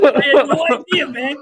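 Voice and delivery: laughing. Real, not acted. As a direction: fight off real laughter as you say it. Repeats the line, still laughing.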